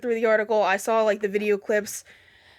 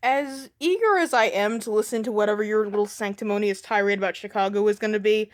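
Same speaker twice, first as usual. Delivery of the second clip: usual speaking voice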